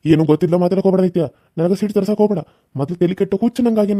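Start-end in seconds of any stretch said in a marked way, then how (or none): 0.0-2.4 loud, fast angry warning. Acted. Uncle to niece who questions him, loud fast angry warning. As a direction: bite her off before she says more.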